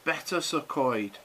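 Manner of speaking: "Better Coed" said slowly; slow Welsh demo. Shown as a demonstration. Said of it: "This one".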